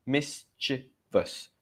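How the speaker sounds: "Mischievous" broken slowly into mis, chuh, vus; syllable by syllable. Shown as a demonstration. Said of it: "Not mischievous".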